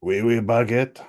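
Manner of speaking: put-on hammy French accent; overdone mock French. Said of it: "offensive French accents today".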